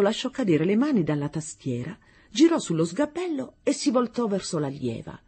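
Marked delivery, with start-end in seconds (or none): none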